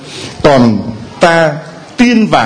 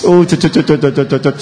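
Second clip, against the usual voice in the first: put-on mother hushing baby; faster than usual. A mother rocking and calming her crying baby. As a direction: lull a crying baby in your arms. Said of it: "Chúa như người mẹ vẫn cứ ẵm".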